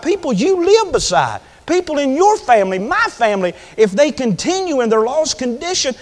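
high, urgent pulpit cry; fervent preacher, voice pushed high and strained; impassioned preaching. Real, not acted. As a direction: plead with the congregation at full pitch. Preaches at full pitch.

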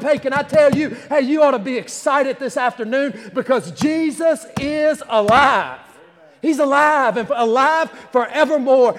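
high, loud, driving sermon delivery; impassioned pulpit crescendo; fervent preaching. Real, not acted. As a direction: preach it at full force, phrase piled on phrase. Preaches at full force.

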